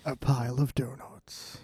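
trailer voice-over. Shown as a demonstration. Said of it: "a movie phone or, like, a trailer".

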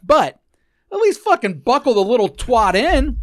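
high, loud swearing rant; swearing tirade. Real, not acted. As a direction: rip into her, voice up.

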